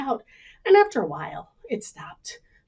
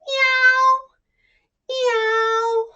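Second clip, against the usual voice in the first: high, loud mewing like a kitten; high kitten meow; 7 dB louder than usual. Acted. Kitten announcing itself, high mewing. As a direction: sing out a little meow.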